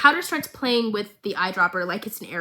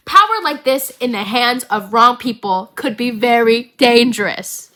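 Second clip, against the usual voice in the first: much louder, hammed-up repeat of the line; dramatic repeat; 9 dB louder than usual. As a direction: boom the warning out like a trailer.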